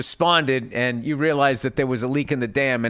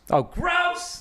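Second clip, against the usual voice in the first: "gross" squealed much higher; exaggerated disgust. Shown as a demonstration. Bill Burr-style "gross" reading, pitched way up. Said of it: "Like I say it".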